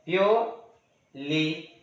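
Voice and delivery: Purely slowly, pure, long pause, ली; syllable-by-syllable demo. Shown as a demonstration. Teacher demonstrates a two-syllable word, syllable by syllable. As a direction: break purely into two separate beats. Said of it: "दो बार pronounce करने के बाद".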